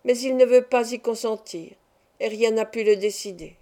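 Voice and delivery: plain reading voice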